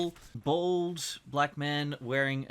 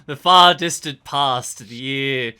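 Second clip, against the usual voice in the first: mock-grandiose; 12 dB louder than usual. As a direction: intone it like an ancient legend. Joke about a distant era.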